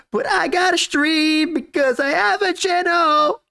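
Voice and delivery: high, slow, whiny mock lament; mock crying streamer. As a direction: whine it out like a pitiful streamer close to tears. Mocking a streamer's sad lament.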